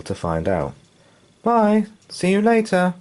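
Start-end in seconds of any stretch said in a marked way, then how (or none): 1.4-2.9 put-on high, bright child calling out; lightly voiced boy waving off; cheerful boy. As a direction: call goodbye over the shoulder. Boy calls goodbye.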